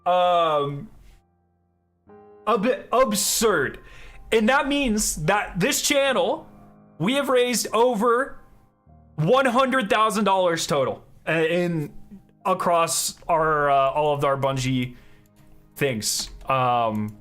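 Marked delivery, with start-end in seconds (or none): 0.0-11.0 higher, louder, amazed announcement; laughing with joy at the total; excited donation thanks. Real, not acted.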